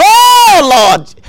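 loud high cry to the Lord; mock pleading cry. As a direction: wail it like a deacon begging on his knees. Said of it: "begging the Lord".

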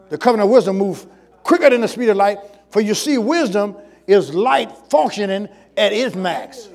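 fast, emphatic restatement of the statement. Real, not acted. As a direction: run the phrases together briskly and firmly. Repeats the statement in running flow.

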